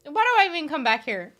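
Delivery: loud, high exasperated yell; mock outrage.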